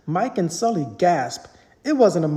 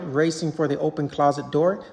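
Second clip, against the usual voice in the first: plain reading voice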